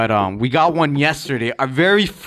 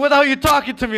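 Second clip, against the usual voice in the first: mock exasperated yell; 11 semitones higher, faster than usual. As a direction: snap at him in disbelief. Friend mocking Dre's soft talk.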